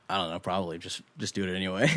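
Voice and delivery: low, noncommittal mock reply; shrugging quote. Retelling a man's unhelpful advice, shrugging. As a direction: imitate a half-hearted shrug of an answer.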